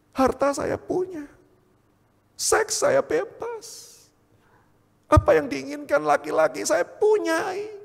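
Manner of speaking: high, slow, drawn-out lament; anguished retold protest. Man confessing to his mirror all he owns, anguished protest. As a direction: stretch each boast into a pained cry.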